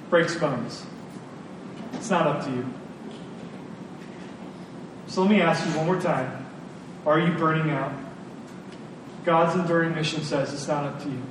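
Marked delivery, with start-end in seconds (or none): none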